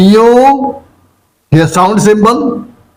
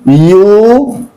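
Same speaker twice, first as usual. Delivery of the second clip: ordinary delivery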